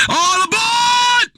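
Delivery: high, drawn-out train conductor call; stretched, high-pitched boarding call; conductor's yell. Acted. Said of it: "somebody gonna yell out".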